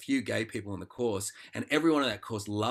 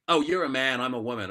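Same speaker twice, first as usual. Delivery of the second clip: louder, higher start, easing off; mock pointing out differences; 3 semitones higher, 7 dB louder than usual. People leading with their difference, mock exclaiming. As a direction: act out a lightly exaggerated realisation.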